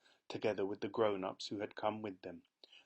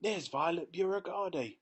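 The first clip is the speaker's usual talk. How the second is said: higher, louder excited spotter; crowd member shouting; 8 semitones higher, 4 dB louder than usual. A narrator's reading. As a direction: point her out from the crowd. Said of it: "he heard someone shouting".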